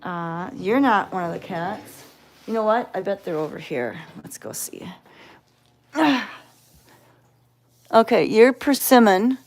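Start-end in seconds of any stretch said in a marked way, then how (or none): 4.2-4.9 hushed whisper, no voice. Real, not acted. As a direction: whisper so the cats stay calm. Sneaking up on shy cats.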